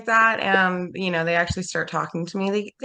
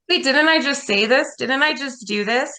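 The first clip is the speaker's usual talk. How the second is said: higher-pitched mock puzzlement, rising at the end; quoted inner second-guessing, pitched up; puzzled self-questioning; 7 semitones higher than usual. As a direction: act out catching yourself mid-doubt.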